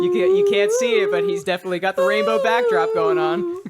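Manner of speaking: loud, high, giddy; squealing with delight at the bit; excited high laugh-talk. Real, not acted.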